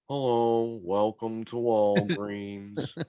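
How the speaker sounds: put-on very slow, drawn-out old man greeting; slow old clerk. Mocking the slow old Walgreens clerk with a very slow drawn-out greeting. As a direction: stretch every word like a tired old clerk. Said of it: "took his time doing everything, even talking".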